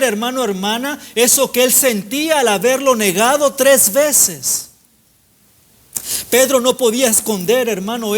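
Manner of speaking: insistent preacher hammering each repeated phrase; impassioned preaching. Real, not acted. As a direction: preach with driving, sustained energy. Preaches at full force.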